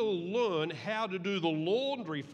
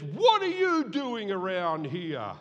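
loud, slow angry demand; mock angry demand; angry brother; 7 dB louder, slower than usual. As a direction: scold him like a furious big brother. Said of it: "he was angry".

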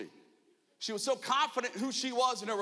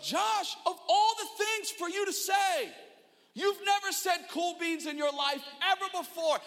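scolding himself; 8 semitones higher than usual. Retold or imitated. Man scolding himself after a blunder, mock exasperated. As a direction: berate yourself in disbelief. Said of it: "talking to myself".

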